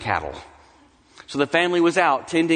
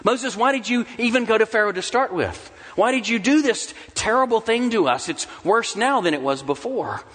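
raised, higher accusing complaint; people's complaint; 7 semitones higher than usual. Acted. The people complaining to Moses, higher and accusing. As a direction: whine it at Moses, pitch up.

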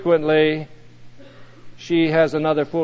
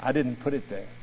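somber; 5 semitones lower, 11 dB quieter, faster than usual. A narrator's reading. The Lord confides to the angel, somber and hushed. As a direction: lower and much softer, grave. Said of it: "The Lord looked somber".

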